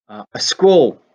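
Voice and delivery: squirrel in a British accent, no R, skwol. Shown as a demonstration. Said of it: "go for a more U K kind of accent".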